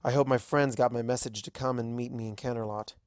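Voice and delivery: ordinary delivery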